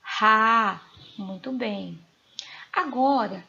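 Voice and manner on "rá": "Rá" called out loud and held; stressed syllable isolated. Shown as a demonstration. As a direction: punch "Rá" as a long, strong syllable. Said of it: "Qual é a sílaba tônica da palavra rápido?".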